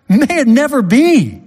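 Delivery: high and loud, a flat refusal; emphatic denial. Shown as a demonstration. Preacher thundering Paul's answer to the objector, high and loud. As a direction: slam the door on the idea. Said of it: "the strongest, most emphatic negative statement".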